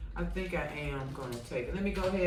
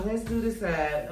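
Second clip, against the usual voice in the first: ordinary talk, nothing marked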